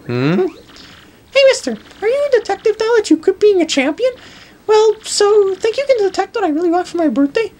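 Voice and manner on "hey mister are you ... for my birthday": put-on very high, quick kid voice; little kid. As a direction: pipe up high like an eager child. Voices a child character.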